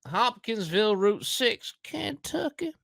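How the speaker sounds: high, slow mock American accent; exaggerated high Kentucky drawl. Shown as a demonstration. Reading the witness statement aloud in a put-on American accent. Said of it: "read it in an American accent".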